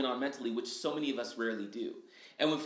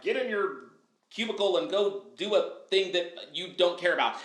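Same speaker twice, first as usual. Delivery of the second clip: bossy boss; 3 semitones higher, 4 dB louder than usual. Retold or imitated. Boss voiced ordering him.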